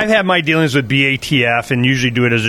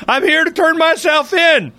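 mock confession; 11 semitones higher than usual. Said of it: "went to the B A T F office and said".

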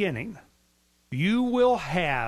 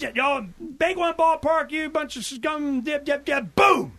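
imitated yelling; 9 semitones higher, slower than usual. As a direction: act out a crazed man yelling, then explode. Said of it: "he's yelling something about the".

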